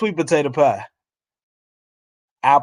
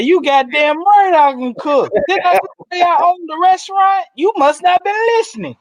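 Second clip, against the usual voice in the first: proud boast; 10 semitones higher than usual. Shown as a demonstration. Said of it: "you supposed to say".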